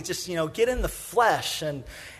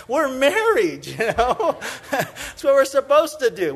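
laughing retort; 5 semitones higher than usual. Retold or imitated. Pastor laughing as he answers the kids who tease him. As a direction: laugh it off while defending the kiss.

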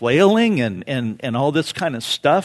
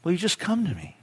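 softer, higher, gentle appeal; softened plea; 6 semitones higher, 6 dB quieter, faster than usual. Acted. Jesus making a last appeal, softly pleading. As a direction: drop from the cry into a tender request. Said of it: "That very cry. He says".

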